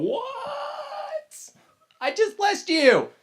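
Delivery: high squeak of disbelief, then slow; shocked Isaac. Retold or imitated. Teacher voicing blind Isaac stunned by Esau, high shocked squeak. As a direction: gasp out the disbelief at full pitch.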